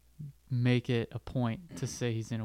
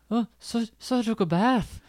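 much higher, louder mock Rudy line; mock squeaky Rudy; 8 semitones higher, 4 dB louder than usual. Friends mocking Rudy's excuse, a high squeaky parody.

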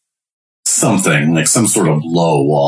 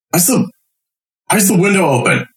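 suspicious guard voice; 12 semitones higher than usual. Acted.

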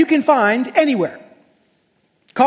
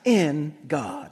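slow self-sermon; 6 semitones lower, much slower than usual. Acted. Preacher voicing David calming his own soul, slow and low. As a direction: lay each word down gently and slowly. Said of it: "David starts preaching to himself".

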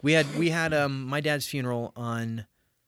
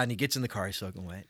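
normal talking voice

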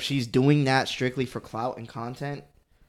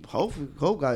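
usual speaking voice

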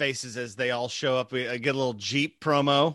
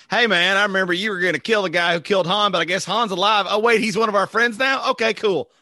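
higher-pitched, casual imagined greeting; Scuzz; 5 semitones higher, 4 dB louder than usual. Acted. Imagining Scuzz greeting an old enemy, higher mock character voice. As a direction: play a friend catching up, pitched up. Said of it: "Scuzz will come over and be like".

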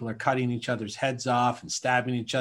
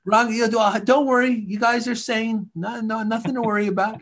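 high, loud mock reassurance; fake-soothing alien; 9 semitones higher, 5 dB louder than usual. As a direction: reassure them like a nervous alien edging away. Alien backing away, reassuring.

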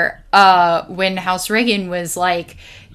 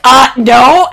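loud flat refusal; 5 semitones higher, 9 dB louder than usual. Retold or imitated. Refusing student's scheme.

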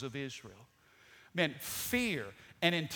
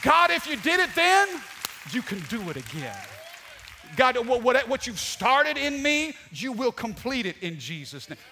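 bold prayer; 7 semitones higher, 8 dB louder than usual. Shown as a demonstration. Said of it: "Start talking like that".

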